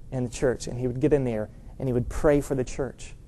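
low, soft voice of a janitor's wife; quiet, earnest retelling. Retold or imitated. Janitor's wife revealing her husband's prayers to pastor, quiet and low.

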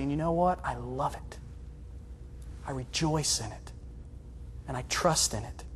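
soft, low, heartfelt declaration; quiet trust. Acted. Believer accepting God's plan, quiet trust. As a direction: say it gently and low, with settled assent.

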